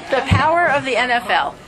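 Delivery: laughing disbelief at the words The power of the N F L; incredulous repeat. Retold or imitated. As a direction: repeat it as if absurd. Repeating the coach's odd answer.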